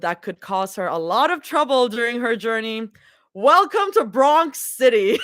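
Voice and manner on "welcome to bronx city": laughing. Acted. Reading sign, laughing.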